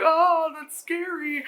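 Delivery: high, drawn-out fake-scared wail; hammed-up scare, slow and high; mock fright. Girls see the old naked woman, mock fright.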